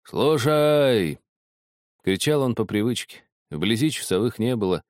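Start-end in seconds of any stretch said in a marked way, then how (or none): 0.0-1.2 a sentry's loud, higher call; hoarse shout. A narrator's reading. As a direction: call it out across the ice. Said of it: "хрипло кричал".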